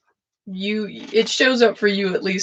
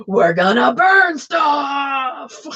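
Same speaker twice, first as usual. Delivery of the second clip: mock universe threat; 5 semitones higher, much slower than usual. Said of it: "they're like".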